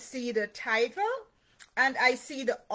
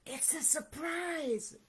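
soft, high hush; whispered; 5 semitones higher, 7 dB quieter than usual. A narrator's reading. Duck letting Mouse in on the surprise, softly whispered. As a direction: share a secret softly. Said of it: "whispered Duck".

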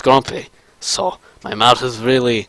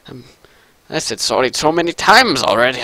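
normal talking voice

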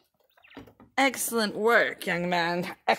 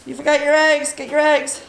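called out higher and louder; calling out; 6 semitones higher, 5 dB louder than usual. Real, not acted. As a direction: call across the yard. Calling to child outdoors.

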